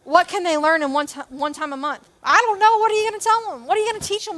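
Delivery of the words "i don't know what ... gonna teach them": high, loud mock excuses; mock doubtful volunteer. Pastor mimics a hesitant volunteer in a high mock voice. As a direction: play the unsure volunteer who has nothing to teach.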